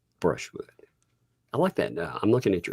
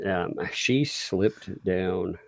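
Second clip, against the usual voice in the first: usual speaking voice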